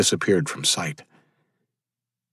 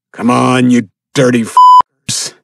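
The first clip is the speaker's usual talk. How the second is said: loud, slow, higher defiant yell; screaming challenge; 5 semitones higher, 8 dB louder, slower than usual. Acted. Father screams down the slope at the cannibals, loud and defiant. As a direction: scream down the hill at them. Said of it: "he screamed down the slope".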